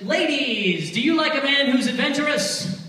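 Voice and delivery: loud, lifted hype-man call; calling out to the crowd. Real, not acted. Comedian polling the ladies in the audience, calling out. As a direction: throw the question out loud to the room.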